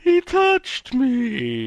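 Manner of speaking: very high, slow, drawn-out ghost voice. Acted. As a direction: wail it like a little ghost. [name] plays Gary Coleman's ghost.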